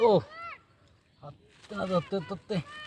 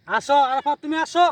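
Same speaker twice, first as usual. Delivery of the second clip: high, loud call climbing higher; 10 semitones higher, 7 dB louder than usual. Real, not acted. Fisher summons a friend over, calling out loudly. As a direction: holler for someone far off to come.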